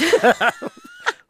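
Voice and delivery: high squealing burst of laughter; shrieking laugh. Real, not acted.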